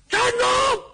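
a huge high bellow to halt the convoy; bandit's shout. Acted. Cheng Yaojin bars the road and bellows at Luo Cheng, full-throated shout. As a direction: roar it out so the whole road stops. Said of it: "程咬金嗷唠一声喊".

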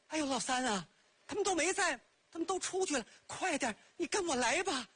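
a hint of a scheming woman; wheedling sister-in-law. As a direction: sweet-talk him into following you. Sister-in-law luring brother-in-law.

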